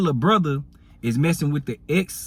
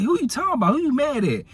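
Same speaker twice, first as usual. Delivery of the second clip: much higher, sharp demanding questions; alarmed questioning; 11 semitones higher than usual. Retold or imitated. Girlfriend questioning her brother on the phone, alarmed. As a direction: press him, alarmed and higher.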